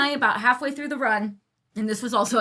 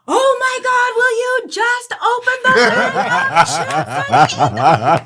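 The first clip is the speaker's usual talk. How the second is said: much higher and louder, fed-up plea; exasperated Paolo; 13 semitones higher, 9 dB louder, much slower than usual. Retold or imitated. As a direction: beg the chip-eater to just get on with it. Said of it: "Paolo goes".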